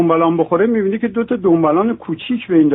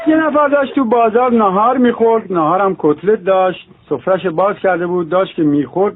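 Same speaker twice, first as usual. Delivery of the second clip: usual speaking voice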